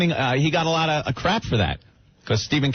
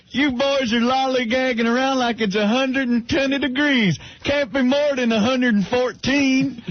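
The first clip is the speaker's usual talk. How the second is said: movie foreman; 9 semitones higher than usual. Retold or imitated. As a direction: yell like a sweaty railroad boss. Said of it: "he goes".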